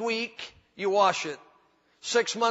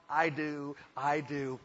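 soft mock vow; 6 semitones lower, 8 dB quieter, slower than usual. Couple's wedding vow voiced.

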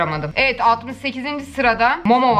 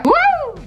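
a sudden high, loud "woo"; high whoop; 4 dB louder than usual. Real, not acted. Viewer whoops at a pretty photo, high and loud.

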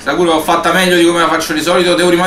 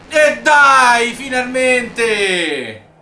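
relieved cry; 6 semitones higher, much slower than usual. Real, not acted. Relief after winning.

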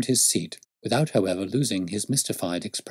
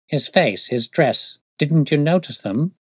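raised, insistent questioning; impatient interruption; 6 semitones higher, 6 dB louder than usual. Acted. Detective breaks in on rambling constable, mildly impatient demand. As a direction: press him with a touch of impatience. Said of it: "Holmes broke in impatiently".

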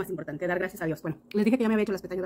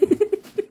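high, loud teary laugh; laughing through tears; choked laugh-sob; 11 semitones higher, 6 dB louder than usual. Real, not acted. Vlogger moved by her gifts, teary laugh-sob.

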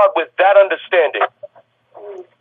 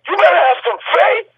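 high, shouted sermon climax; preaching at full force; 6 semitones higher than usual. Acted. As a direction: lift the room with each "you better". Pastor at peak preaching.

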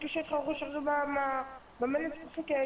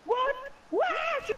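shocked; 12 semitones higher than usual. Real, not acted. Surprised by hacker claim.

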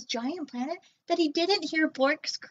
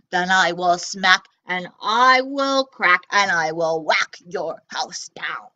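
low robot threat fading to a whisper; threaten, then hiss the end; 4 semitones lower, 7 dB louder than usual. Acted. Robot threatening Nezlex's house, low then whispered. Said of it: "zeeped the robot".

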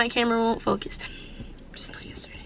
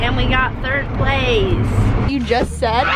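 ordinary talk

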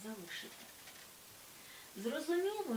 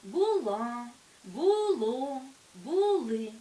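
Була, було, були with loud stressed endings; stress on second syllable; 9 dB louder, much slower than usual. Shown as a demonstration. Teacher showing past-tense stress, Була, було, були loud on endings. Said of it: "наголос падає на другий склад".

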